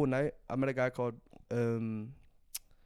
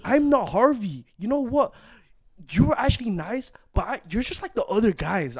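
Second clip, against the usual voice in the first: put-on higher, hurt girl's voice; mock offended girl; 6 semitones higher, 5 dB louder than usual. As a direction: play the offended girl brushing him off. Voicing the girl on phone.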